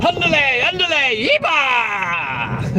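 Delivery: high hammed-up mock Mexican shout, a little lightly done; playful, lightly voiced Mexican call; mock Mexican cry. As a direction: sing out the punchline in a jokey fake Mexican voice. Punchline of Mexican joke.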